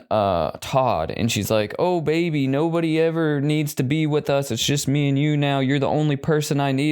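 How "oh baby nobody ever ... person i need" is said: pitched-up cooing mother, mock tender; doting mom voice. Mom cooing to her son Todd, mock tender. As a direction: coo at him like a clingy mom.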